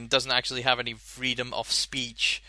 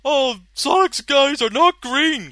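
high, loud ranting fanboy voice; 12 semitones higher, 8 dB louder, slower than usual. Retold or imitated. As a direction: whine it like an outraged fan. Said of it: "kind of, like, ranting style".

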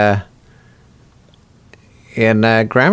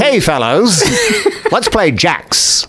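higher, slow, prissy schoolboy calling pals; prim little boy; 11 semitones higher, much slower than usual. Pretend. Guest voicing his precise boyhood self inviting friends to jacks. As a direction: play the precise kid with a laugh breaking in. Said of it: "Not that Doctor Spock sounds like that".

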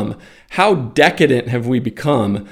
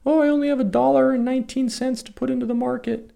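much higher, fast, silly voice; mock tiny investor; 11 semitones higher, 4 dB quieter, faster than usual. Mocking someone who buys one share, high and fast. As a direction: play a naive penny investor. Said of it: "Who buys one share?".

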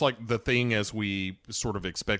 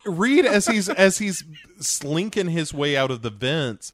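usual speaking voice

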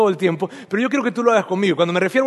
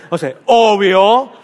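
slow, louder "obvio" with a laugh, rising at the end; obvious tone; 7 dB louder, much slower than usual. Shown as a demonstration. Pastor shows the congregation how obvious it should sound, laughing. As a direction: laugh it out like it goes without saying. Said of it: "con un acento de obvio".

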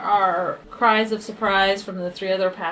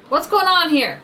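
guard voice; 7 semitones higher than usual. Acted.

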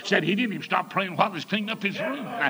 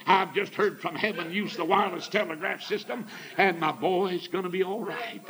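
ordinary delivery

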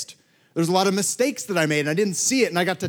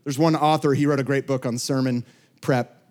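ordinary talk, nothing marked

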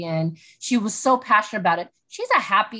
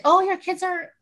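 higher, mock-gushing praise from other people; gushing onlooker; 8 semitones higher than usual. People praising her kids, mock sweet and gushing. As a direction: coo it like an admiring neighbour. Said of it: "always say".

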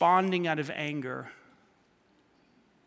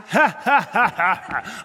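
imitating son's laugh; 5 dB louder, slower than usual. Son gloating about taking the minivan, mock laugh. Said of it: "He's laughing".

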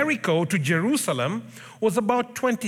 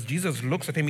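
usual speaking voice

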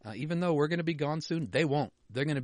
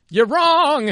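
high, loud cracking yell; voice cracking shout; 14 dB louder than usual. Real, not acted. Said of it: "That was a weird voice cracking".

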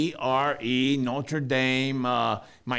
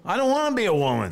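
fast, higher whiny objection; mock protest; 6 semitones higher, faster than usual. Host protesting the female Snapchat filter, fast whiny objection. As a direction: whine it like a kid refusing.

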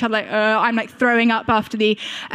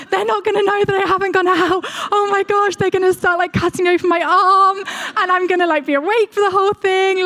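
higher and louder, rushed frightened thoughts; panicking; 9 semitones higher, 4 dB louder than usual. Retold or imitated. Child under the gas mask, panicking, higher and louder. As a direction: voice the child's rising panic out loud. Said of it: "beginning to panic".

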